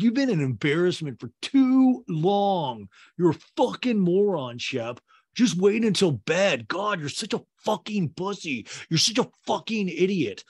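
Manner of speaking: heated retold rant; mock angry tirade. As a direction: imitate an exasperated friend hurling insults. Acted quarrel, insults hurled at Shep.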